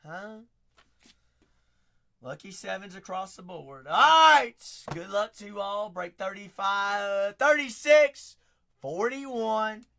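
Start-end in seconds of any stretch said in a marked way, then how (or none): 3.8-4.6 loud high shout; loud excited all right; hype shout. Real, not acted. Hype before break. 5.9-9.8 loud, slow, higher break announcement; announcer call. Real, not acted.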